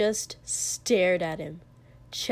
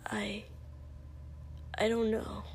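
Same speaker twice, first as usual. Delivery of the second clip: hesitant, hushed; 11 dB quieter than usual. Acted. Shaken reply to whispered plea.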